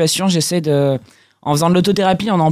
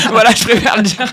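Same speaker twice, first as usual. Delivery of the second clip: laughing; 4 semitones higher, 6 dB louder than usual. Real, not acted. Laughs after joke about YouTube.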